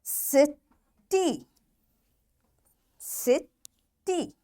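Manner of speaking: "City" as si, long pause, tee; split into syllables. Shown as a demonstration. Teacher models two syllable Y words, syllable by syllable. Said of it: "It will sound like long E sound".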